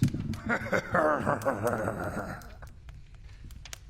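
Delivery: long villain laugh; evil cackle. Acted. Vampire cackling at Hans over the rules.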